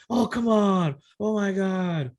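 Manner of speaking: put-on exasperated kid voice; imitating frustrated gamer. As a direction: whine like a kid losing a game. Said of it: "He gets frustrated".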